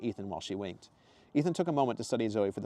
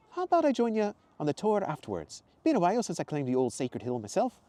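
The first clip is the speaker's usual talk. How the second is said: much higher, louder cheerful woman's voice; Zoe's exclamation; 9 semitones higher, 4 dB louder than usual. Acted. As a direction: greet him brightly and high. Zoe voiced.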